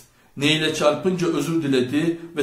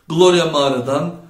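ordinary delivery

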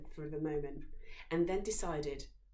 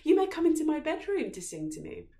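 high, loud, breathless-eager princess; 11 semitones higher, 10 dB louder than usual. A narrator's reading. Princess eagerly inviting the singer into her bedroom. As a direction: invite him in with excitement. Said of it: "she said to him again".

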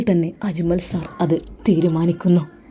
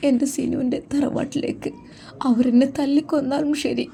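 put-on higher, soft pleading young woman; Muhsina's voice; 7 semitones higher, 3 dB quieter than usual. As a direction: plead softly as the frightened girl.

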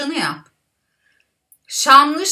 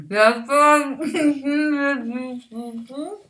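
slow, mushy words through stuffed cheeks; cheeks stuffed full. Real, not acted. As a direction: barely get words past a full mouth. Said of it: "Ağzımda yer kalmadı".

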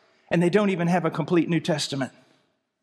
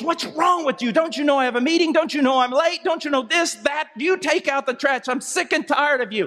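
higher-pitched, ranting angry husband; fit of rage; 5 semitones higher than usual. Acted. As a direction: fire off furious complaints at the wife. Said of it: "He turns around in a fit of rage".

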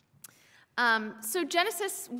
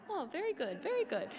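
soft, lukewarm "very good"; polite mild praise; 7 semitones higher, 4 dB quieter than usual. Shown as a demonstration. Preacher contrasting lukewarm praise with passion, soft and polite. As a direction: say "very good" softly, as mere politeness. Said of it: "It's not like".

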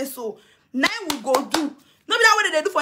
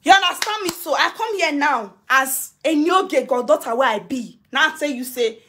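normal talking voice

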